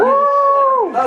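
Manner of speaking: high, loud surprised oh; a sudden high yelp of surprise. Real, not acted. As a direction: caught off guard by the cheer. Surprised at cheering seniors.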